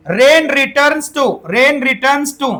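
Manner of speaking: slow, spaced reading; slow dictation. Shown as a demonstration. Teacher reading the exam question to the class, slowly. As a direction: read each word out for them to note.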